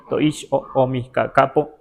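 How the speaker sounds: "Toish o, o mi ca-, capo" said clearly with "capo" stressed; teacher repeats the phrase as the model to copy; clear model pronunciation. Shown as a demonstration. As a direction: pronounce it just as it sounds. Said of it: "Así como suena".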